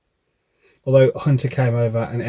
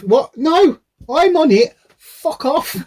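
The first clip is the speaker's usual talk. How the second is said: mock outraged; 5 dB louder, slower than usual. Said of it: "I literally go".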